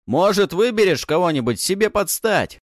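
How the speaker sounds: loud, high, mocking challenge; taunting hero. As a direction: throw down a cocky dare.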